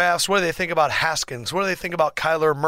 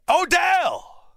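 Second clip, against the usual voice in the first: high shouted "Odell!" falling away; high fan's shout of "Odell!"; excited shout; 13 semitones higher than usual. Acted. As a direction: yell his name like a fan. Hype shout of the name.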